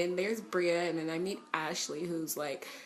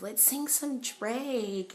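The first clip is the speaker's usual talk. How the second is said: higher, drawn-out invitation; eager suggestion; 6 semitones higher, much slower than usual. Retold or imitated. Ashley getting the fans to sing, eager. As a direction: stretch it out eagerly. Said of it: "Ashley's like".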